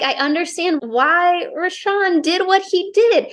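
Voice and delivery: high-pitched, dramatized reluctant admission; torn, conflicted. Acted. Heroine debates herself about the killer, mock-anguished and torn. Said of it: "a torn person's perspective".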